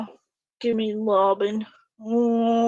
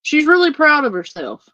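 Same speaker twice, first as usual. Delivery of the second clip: mocking; 7 semitones higher, 7 dB louder than usual. Real, not acted. Mocking the dancing woman.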